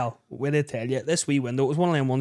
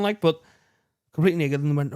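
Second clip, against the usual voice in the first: normal talking voice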